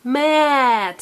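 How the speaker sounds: "Mat" drawn out long; said slowly. Shown as a demonstration. Teacher correcting a child's quick take, drawn out. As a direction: stretch "Mat" out sound by sound.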